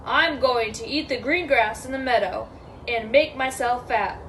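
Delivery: steady big-goat voice, no stammer; lightly voiced bold goat; unafraid big goat. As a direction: state it evenly and confidently.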